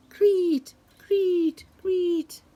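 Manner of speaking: high, loud creet creet; cricket chirp. Retold or imitated. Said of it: "The crickets go".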